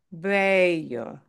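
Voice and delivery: bello slowly, first syllable stressed; slow, careful bello with weight on "be". Shown as a demonstration. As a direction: draw out the "be" of bello. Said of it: "stress the second to last".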